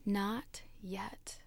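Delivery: silent mouthed whisper. Acted. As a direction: whisper it as if only lips move. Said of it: "I could read his lips".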